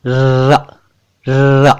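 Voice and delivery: र held as a long trilled r; rolled र. Shown as a demonstration. Teacher asking viewers to listen carefully, long rolled र. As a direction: trill र with the tongue by the teeth. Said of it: "जबान और दांतों की सहायता से".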